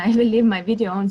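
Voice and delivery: laughing through the correction; quick amused correction. Real, not acted. Laughs at own slip.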